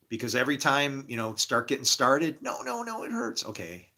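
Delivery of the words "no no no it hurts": much higher, squeaky "no, no, no"; high-pitched imitation of a hesitant girlfriend; mock high girlish protest. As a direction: play the girlfriend in a high little voice. Girlfriend protesting in intimate moment.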